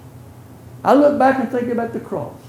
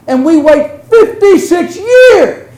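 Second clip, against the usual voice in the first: higher, louder, slow with a laugh in it; incredulous; 9 semitones higher, 8 dB louder, slower than usual. Acted. Preacher to the congregation, laughing disbelief. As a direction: laugh at the absurdity of it.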